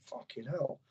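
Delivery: hushed, half-breathed fucking hell; a quiet stunned swear; awed under breath. Retold or imitated. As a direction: let it slip out under your breath in awe. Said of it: "and I was like".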